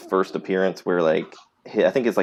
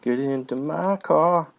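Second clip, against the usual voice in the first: creepy stranger voice; 3 semitones higher, slower than usual. Pretend.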